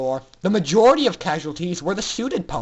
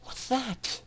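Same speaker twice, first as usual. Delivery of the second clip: higher, soft hushed ask; hushed question; 5 semitones higher, 10 dB quieter than usual. A narrator's reading. Aurora answering Scootaloo's secret plan, hushed. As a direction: whisper back eagerly.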